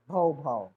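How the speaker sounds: low, loud barking like a big dog. Shown as a demonstration. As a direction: bark it out like a large dog. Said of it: "The large dog said".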